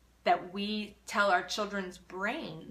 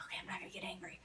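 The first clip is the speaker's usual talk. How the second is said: soft whisper to yourself; whispered self-calming; whispered self-talk; 13 dB quieter, much faster than usual. Retold or imitated. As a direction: talk yourself down under your breath.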